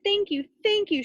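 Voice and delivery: high, overjoyed thanks; crying thanks. A narrator's reading. Townspeople thank Stregonona for saving the town, high overjoyed thanks. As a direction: gush your gratitude. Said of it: "the people cried".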